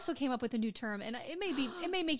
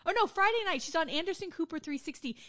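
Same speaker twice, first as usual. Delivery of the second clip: ordinary delivery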